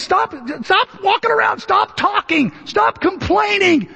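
mock Moses snapping at the grumblers, slowing at the end; scolding Moses.

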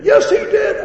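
high, loud insistence; shouted contradiction. Retold or imitated. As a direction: imitate an irate customer insisting. Acting customer's shouted contradiction.

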